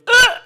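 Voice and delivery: a very high, loud choked grunt; alligator grunt. Retold or imitated. Gator choking on the cork, loud grunt. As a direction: bark out the gator's gag.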